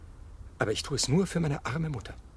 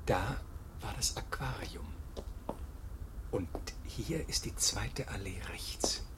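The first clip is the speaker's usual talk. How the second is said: nervous whisper; 3 semitones lower, 7 dB quieter than usual. Acted. Nervous man finding meeting place.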